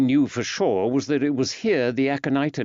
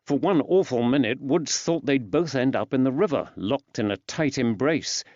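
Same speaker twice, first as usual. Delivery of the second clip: plain reading voice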